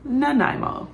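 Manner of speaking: "Nanaimo" as nuh-NYE-mo, pitched high then falling; pronunciation demo. Shown as a demonstration. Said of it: "different ways people are pronouncing it".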